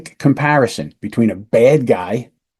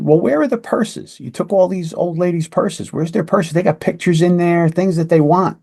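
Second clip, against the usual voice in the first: pressing question; 6 semitones higher than usual. Retold or imitated. Detective presses about purses.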